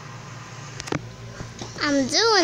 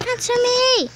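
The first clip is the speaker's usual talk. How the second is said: high, insistent call for an answer; demanding shout; 8 semitones higher than usual. Acted. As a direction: demand a reply at a raised pitch.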